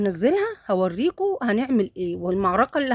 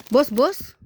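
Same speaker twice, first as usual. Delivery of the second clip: higher and louder pet call; 6 semitones higher, 5 dB louder, much slower than usual. Real, not acted. Calling the cat to look, high and louder. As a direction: sing out to the cat.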